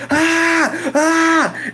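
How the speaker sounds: two high, loud cries of pain; pained scream. Retold or imitated. Patient hurting during a hospital exam, pained scream. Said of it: "I fucking screamed".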